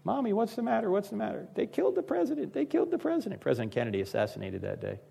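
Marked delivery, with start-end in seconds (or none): 0.0-1.5 put-on small, higher child's voice, softer; child asking his crying mother what is wrong, in a small high voice; toddler voice. Toddler asking weeping mom. 1.6-3.4 high, soft, tearful mother's voice; mother's grief, imitated softly and high; young mother crying at the TV news, imitated high and soft; imitating a weeping mother. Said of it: "weeping uncontrollably".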